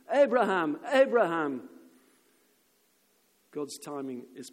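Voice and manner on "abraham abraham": loud, urgent call from heaven. Acted. Angel stops Abraham in the nick of time, calling out. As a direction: call the name out hard, twice. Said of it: "called out to him from heaven".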